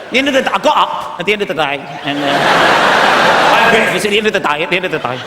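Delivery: mock a dim footballer interview; lightly voiced footballer; footballer impression. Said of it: "You see footballers".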